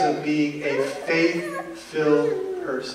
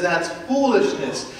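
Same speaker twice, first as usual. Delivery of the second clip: a hint of a scoffing doubter, pitched up; mocking skeptic; 6 semitones higher than usual. The world taunting believers, mild mock scorn. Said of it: "the world comes to us and says".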